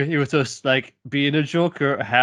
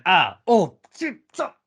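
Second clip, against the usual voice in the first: mock pained protest; 5 semitones higher, slower than usual. S1 voicing astral Harley's protest.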